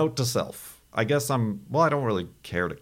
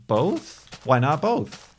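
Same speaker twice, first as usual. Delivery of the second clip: playful; 8 semitones higher, 4 dB louder, much slower than usual. Real, not acted. Playful quoted meme.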